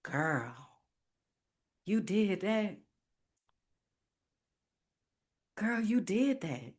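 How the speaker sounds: soft, hushed pride to herself; whisper-close praise to yourself; quietly moved, proud of herself; tender self-praise. Retold or imitated. Talking to herself, alone.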